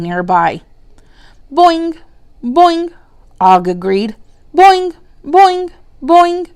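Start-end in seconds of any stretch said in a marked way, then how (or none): 1.5-2.9 high twanging boing; frog twang. Acted. Frog agrees. 4.5-6.5 high, louder twanging boings; frog twang. Acted. Frog agrees.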